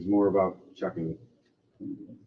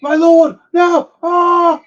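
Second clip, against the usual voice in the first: high, loud anguished shout; knights crying out; 12 dB louder, much slower than usual. Acted. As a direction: wail for your fallen lord. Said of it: "the king's knights".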